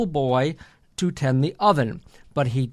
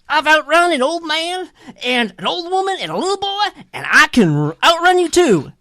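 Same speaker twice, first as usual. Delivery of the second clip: high, fast storybook boast; high-pitched cocky little runaway; boastful cake character; 10 semitones higher, faster than usual. Acted. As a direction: rattle off the brag in a high voice. Voicing boastful Johnny Cake in story.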